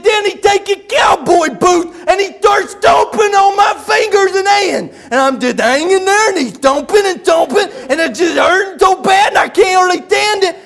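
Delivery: high, loud frantic mock complaint; hammed-up worked-up shouting, tongue-tied; Buddy. Buddy recounts the husband's attack to the preacher, shouting agitatedly. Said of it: "when he gets real worked up".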